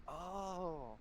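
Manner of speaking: soft, high little "oh"; a small softened coo of wonder; smitten awe. Acted. Small boy taken with a pretty woman, smitten awe. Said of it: "but he's like".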